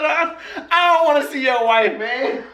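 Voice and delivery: high, laughing through the words; laughing repeat. Retold or imitated. Repeating his protest to his cellmate, laughing.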